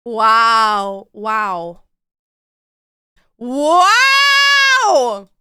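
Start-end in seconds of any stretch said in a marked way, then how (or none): 3.4-5.3 amazed shout. Real, not acted.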